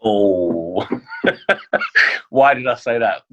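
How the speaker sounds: embarrassed laugh around the words; caught-out laugh. Real, not acted. Chef laughing after a tricky interview question.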